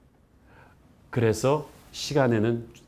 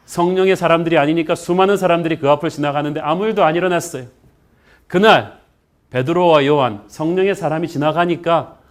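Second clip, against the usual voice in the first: loud, raised sermon storytelling; higher, louder pulpit delivery; forceful preaching; 4 semitones higher, 6 dB louder than usual. Real, not acted.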